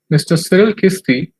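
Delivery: usual speaking voice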